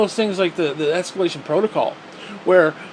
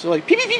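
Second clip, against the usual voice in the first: gun noises; much faster than usual. Retold or imitated. Imitating ship guns.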